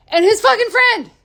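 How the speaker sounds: sudden high, loud burst of rage; angry scream. Real, not acted. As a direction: spit the last words out in fury. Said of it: "I'm sorry to scream about it".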